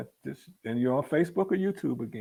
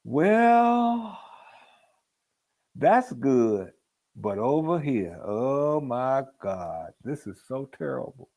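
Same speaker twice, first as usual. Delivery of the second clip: mock dramatic complainer; 2 semitones higher, 5 dB louder, slower than usual. Minister mimicking a worrier, a hammed-up complaint. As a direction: play a whiner who only sees the terrible. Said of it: "It's a lot different than, you know".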